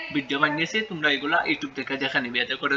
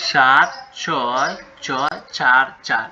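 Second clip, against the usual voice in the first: digits read out very slowly, one by one; slow dictation; 2 semitones lower, much slower than usual. Shown as a demonstration. Teacher showing the class the digits, very slowly. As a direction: give each digit its own slow beat. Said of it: "এই যে তোমরা দেখতে পাচ্ছ".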